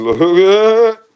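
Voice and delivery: high, loud laughing look; laughing squeal of delight. Real, not acted. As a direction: burst out laughing as you point. Laughs while showing dog.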